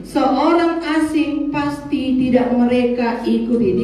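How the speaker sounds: slow emphatic reading. Acted. Rereads verse slowly.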